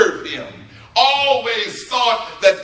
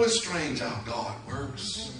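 usual speaking voice

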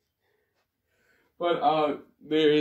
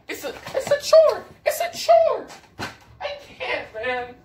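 squeaky laughter. Real, not acted. Losing it over the Falcons.

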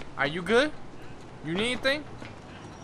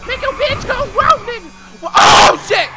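excited; 10 semitones higher, 7 dB louder than usual. Real, not acted. Riding off hyped.

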